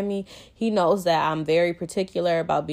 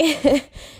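breathless laugh; 9 semitones higher, 5 dB louder than usual. Real, not acted.

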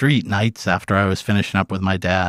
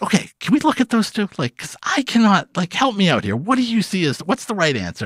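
much higher, pleading questions; pleading for help; 11 semitones higher than usual. Retold or imitated. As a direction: beg him to explain. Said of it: "I'm like".

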